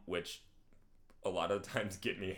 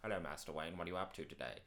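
low, soft butler voice; Alfred; 6 semitones lower, 10 dB quieter, faster than usual. Acted. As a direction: ask gently like a polite butler. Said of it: "all of Alfred and Batman's scenes".

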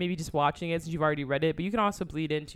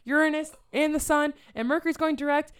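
excited gushing; 9 semitones higher, 6 dB louder than usual. Retold or imitated. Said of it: "I was like".

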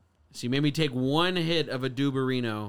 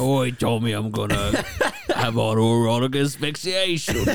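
loud Cockney imitation drifting Australian; Cockney attempt; 5 dB louder than usual. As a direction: attempt a rough Cockney, slightly off. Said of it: "Mine gets, like, Australian".

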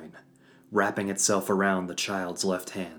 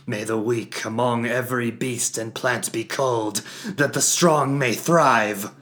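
shouting; 6 semitones higher, 9 dB louder than usual. Acted. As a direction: shout over a roaring hum. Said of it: "shouting over the dull roar".

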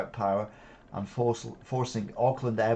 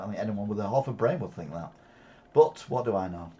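ordinary delivery

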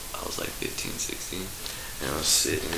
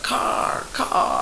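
loud warning call; crow lookout call; 6 dB louder than usual. Acted. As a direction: shout the warning to the homies. Crows calling a warning.

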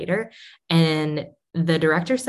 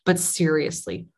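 usual speaking voice

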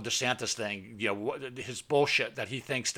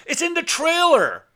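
very high, loud snap; angry friend; 8 dB louder than usual. Retold or imitated. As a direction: fling it back impatiently. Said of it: "he goes".

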